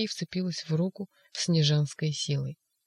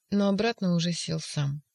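ordinary delivery

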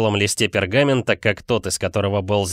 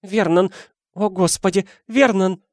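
high, horrified broken exclamations; Aunt Petunia choking; 8 semitones higher than usual. A narrator's reading. Wife calling husband after reading letter, high choked alarm. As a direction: gasp out in rising horror. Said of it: "схватилась за горло и захрипела".

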